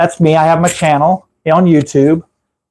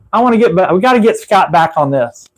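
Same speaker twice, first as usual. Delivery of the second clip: ordinary talk, nothing marked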